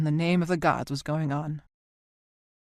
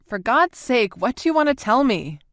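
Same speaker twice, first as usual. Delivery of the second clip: raised, louder, higher outburst; exasperated outcry; 6 semitones higher, 5 dB louder than usual. A narrator's reading. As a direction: burst out, out of patience. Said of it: "Cookie cried".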